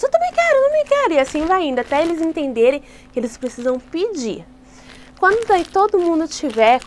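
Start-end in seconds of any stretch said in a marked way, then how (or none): 0.0-1.1 put-on high, pushy child begging for candy; high childish whine; whiny kid. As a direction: beg like an impatient little kid. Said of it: "Daí os outros".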